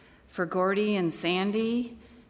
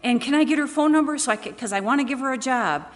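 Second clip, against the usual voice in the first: quoting the caller's eager request, pitched up and fast; a lightly voiced excited lady on the phone; 6 semitones higher, 5 dB louder than usual. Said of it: "She goes".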